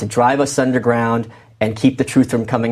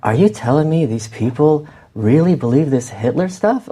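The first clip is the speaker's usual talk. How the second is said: a lightly put-on odd, unplaceable accent, quoting a puzzled man; Jared Taylor's weird accent; 2 semitones higher than usual. Author quoting Jared Taylor to the host in a mild odd accent. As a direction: retell his question in his strange accent, only half-performed. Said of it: "He's got this very weird accent".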